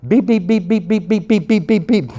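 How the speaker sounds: high-pitched rapid beeping; high, even beeps for cussing; imitated censor beeps. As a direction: bleep out the swearing in a string of beeps. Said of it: "screaming and yelling out in my front yard".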